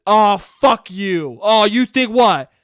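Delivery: loud, drawn-out mock indignation; hostile scorn. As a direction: throw it back slowly and loudly. Acted hostile reply to opinion.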